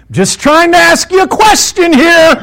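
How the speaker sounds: high, loud, drawn-out yell; re-enacted indignant shouting; exasperated shout. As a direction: holler it in frustration. Shouting at the stopped pickup.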